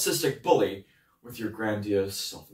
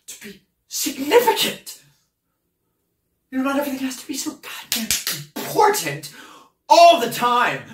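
high, emphatic bursts of anger; fed-up, heated mockery; exasperated rant; 11 semitones higher, 8 dB louder than usual. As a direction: build to a loud, frustrated cry.

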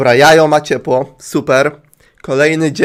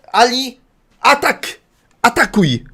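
rally the pet to attack; battle command; 8 semitones higher than usual. Pretend.

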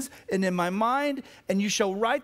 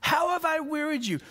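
wounded appeal, voice raised high; God's plaintive appeal; 8 semitones higher than usual. Acted. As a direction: preach it as God's aggrieved plea, pitched well up. Preacher voices God's lament.

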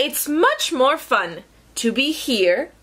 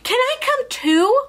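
high, pleading storybook voice; eager giraffe; 11 semitones higher than usual. Acted. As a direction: ask high and hopeful. Giraffe begs to join.

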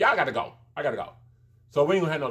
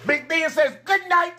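laughing shriek; 5 dB louder than usual. Real, not acted. Laughing relay of goodnight.

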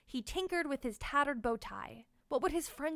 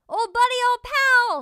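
much higher, much louder joyful shout; 13 semitones higher, 15 dB louder than usual. Acted. Sebastian greeting Tank from the party bus, loud high shout. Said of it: "shouted Sebastian".

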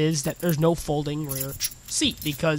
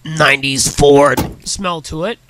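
booming show-off delivery; loud hammed-up; 9 dB louder than usual. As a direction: belt out the nineties Ford smell. Showing off car interior.

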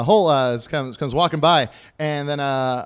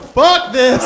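loud, high mock disgust; collective outrage; 13 semitones higher, 3 dB louder than usual. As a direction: burst out like a fed-up crowd. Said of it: "everyone collectively was like".

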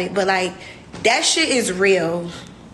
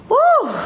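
high, loud "woo" of disbelief; one long, high, astonished "woo"; amazed whoop; 5 dB louder than usual. Real, not acted. Reacting to six children.

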